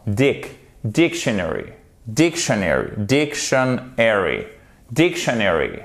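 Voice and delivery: dictionary with DIC punched, the rest falling away; first-syllable stress. Shown as a demonstration. Teacher drilling the very important accent, stress on first syllable. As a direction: model "dictionary" with strong DIC, deliberately. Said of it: "c'est sur la première syllabe".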